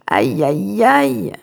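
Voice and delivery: plain reading voice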